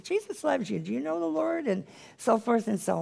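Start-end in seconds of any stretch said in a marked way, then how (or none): 0.0-1.7 much higher, softer tender asking; gentle high-pitched kindness. Retold or imitated. Preacher retells witnessing to old man, high and tender. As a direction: lift the voice up kindly. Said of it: "He said".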